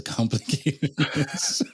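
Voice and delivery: laughing. Real, not acted. Laughing at own complicated story.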